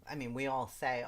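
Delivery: ordinary talk, nothing marked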